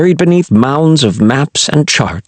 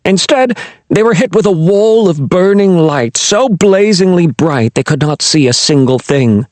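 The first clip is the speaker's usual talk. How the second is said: usual speaking voice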